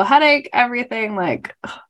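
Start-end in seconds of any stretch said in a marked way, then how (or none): none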